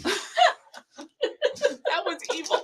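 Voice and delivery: high-pitched laughing through words; can barely talk for giggling. Real, not acted. Cracking up at a coworker's joke.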